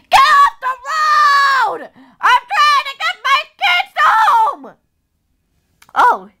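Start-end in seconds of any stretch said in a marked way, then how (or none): 0.1-4.8 high, drawn-out pretend yelling; mommy's road rage. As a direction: wail furiously at the traffic. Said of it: "Mommy's scary when she's in road rage".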